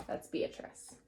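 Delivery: a soft whispered aside. Real, not acted. Teacher pointing out the girl in the picture, whispered aside.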